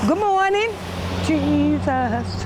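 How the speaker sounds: high, loud cheerful hail; calling out a greeting. Real, not acted. Crossing guard greeting passing kids, loud cheerful call. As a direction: hail the morning across the street.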